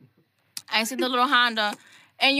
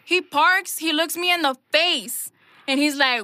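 ordinary delivery

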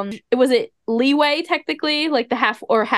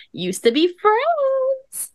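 much higher, stretched-out delivery; high, drawn-out; 13 semitones higher, much slower than usual. Real, not acted. Host teasing about old friends, high and drawn-out. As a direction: draw it out slowly, way up high.

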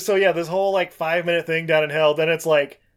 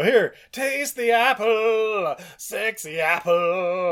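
very slow, higher, seductive snake offering the apple; tempting snake; 4 semitones higher, much slower than usual. Retold or imitated. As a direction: draw it out like a sly tempter.

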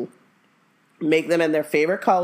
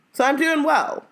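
smug mom; 10 semitones higher than usual. Retold or imitated. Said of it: "she's like".

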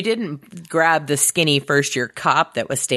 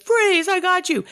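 mock cop shout; 14 semitones higher than usual. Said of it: "and he's like".

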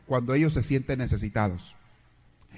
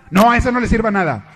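loud, higher snub; spiteful husband; 7 semitones higher, 9 dB louder than usual. Acted. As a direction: spit it out against his wife. Husband snubs wife.

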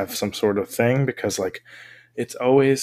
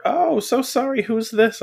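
mock apologetic scammer, much higher; scammer reply; 12 semitones higher than usual. Scammer apologizing for wrong number, high mock voice. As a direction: act the fake surprised stranger, pitched way up. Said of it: "I'll be like".

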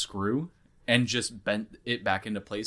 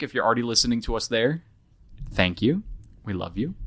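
ordinary talk

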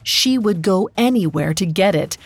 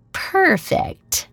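higher, gleeful murmur; Juno's delighted murmur; 12 semitones higher than usual. A narrator's reading. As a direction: purr it with wicked delight. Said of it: "she murmured, delighted".

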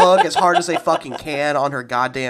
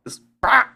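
mock tic outburst; 10 semitones higher than usual. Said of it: "I just have Tourette's".

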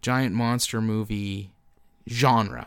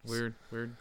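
usual speaking voice

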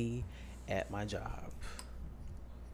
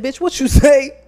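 much higher, much louder confronting yell; furious shout; 12 semitones higher, 18 dB louder than usual. Retold or imitated. Coworker confronting a stranger in the garage, furious shout. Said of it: "I said".

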